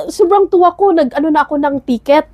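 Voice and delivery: usual speaking voice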